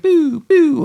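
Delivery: high mock siren wail; retelling the car sirens, high wail; siren imitation. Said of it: "they turn on the sirens".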